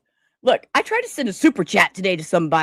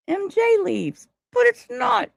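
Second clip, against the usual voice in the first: incredulous; 13 semitones higher than usual. Real, not acted. Shirt disappointment.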